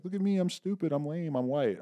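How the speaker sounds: high-pitched mock self-deprecation; mocking imitation. Co-guest imitating people joking about their whiteness, mocking. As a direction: put on a silly high voice to mock them.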